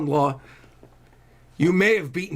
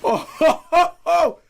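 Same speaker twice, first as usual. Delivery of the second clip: delighted laugh; 10 semitones higher, 4 dB louder than usual. Real, not acted.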